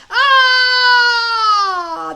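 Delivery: huge, high, loud dragon roar; a giant beast's howl of irritation; monster bellow. A narrator's reading. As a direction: bellow like a tickled sea monster.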